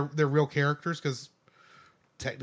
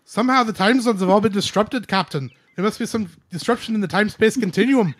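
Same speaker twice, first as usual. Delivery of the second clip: put-on loud, raised Scottish accent; Scotty; 5 semitones higher, 8 dB louder than usual. Engineer reporting disruption to captain, urgent Scottish. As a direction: report trouble in a hammed-up Scottish voice. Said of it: "my Scotty from Star Trek".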